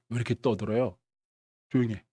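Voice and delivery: low, soft, composed command; coolly authoritative. Acted. Jesus quieting mourners at a house, coolly authoritative. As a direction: hush them with quiet authority. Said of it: "예수님이 딱 멋있게 이렇게 말합니다".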